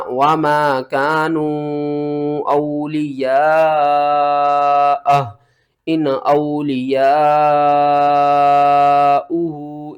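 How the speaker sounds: slow, melodic chanted verse; Quran recitation. Shown as a demonstration. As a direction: recite the verse with long drawn vowels. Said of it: "کس طرح؟".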